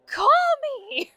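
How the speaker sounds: mock yell back. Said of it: "I just yell back".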